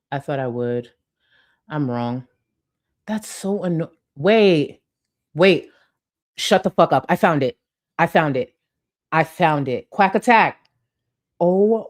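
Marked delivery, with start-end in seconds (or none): 4.2-11.9 louder, thrilled exclamations; real excitement. Real, not acted. Streamer to chat after finding the lost clip, thrilled and loud.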